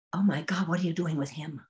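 urgent hushed whisper; waitress whispering. Retold or imitated. Waitress warning her in the ladies' room, hushed whisper. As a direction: whisper it so nobody outside can hear.

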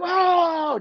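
high excited crowd "Oh!"; crowd gasp. Retold or imitated. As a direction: imitate the crowd erupting. Crowd reacting to the footwork.

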